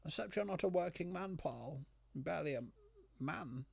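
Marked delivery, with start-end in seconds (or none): none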